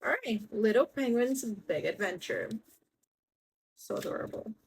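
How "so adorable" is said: soft whispered "So adorable"; whispered gush. Real, not acted. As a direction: breathe it out in a whisper. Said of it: "repeats it in a whisper".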